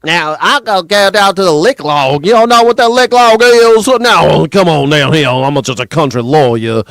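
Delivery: put-on old country lawyer hollering in a thick Southern accent; folksy Southern pappy, big and blustering; Senator John Kennedy. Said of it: "that man, Senator John Kennedy, talks like my pappy".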